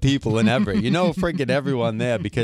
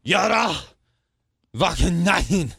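name drawn out slowly and higher; mock announcer call; 4 semitones higher than usual. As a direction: announce "Yara Vakanainen" with a showy, stretched delivery. Shows off the player's name.

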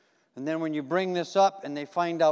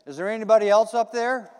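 louder, panicked call upward; a hanging man yelling for anyone else; desperate shout; 5 semitones higher, 10 dB louder than usual. Retold or imitated. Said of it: "the man hanging on to the branch said".

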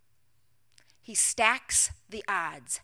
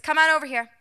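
loud, higher-pitched summons; calling out; 5 semitones higher, 6 dB louder, faster than usual. Acted. Elijah summoning the people to the altar, calling out. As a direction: call a crowd over to you. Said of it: "He calls the people".

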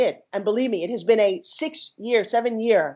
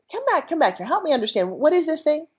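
urgent, higher-pitched call back; eager retold plea; 7 semitones higher than usual. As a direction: imitate calling someone back eagerly.

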